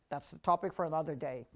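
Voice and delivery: usual speaking voice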